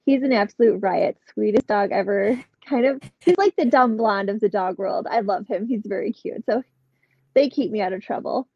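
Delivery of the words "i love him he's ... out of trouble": ordinary delivery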